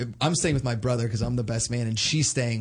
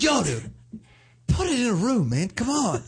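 annoyed brother; 9 semitones higher than usual. Retold or imitated. Brother scolding in hallway.

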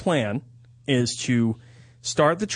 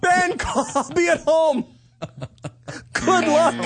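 higher, breathless escaping voice; mock panic; 12 semitones higher, slower than usual. Dad fleeing the awkward scene, laughing in mock panic. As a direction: blurt it while running off.

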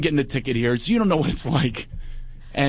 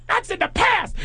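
very high shouted protest; mock hysterical squeal; panicked queeny outburst. Imitating friend arguing over his warrant, mock panic.

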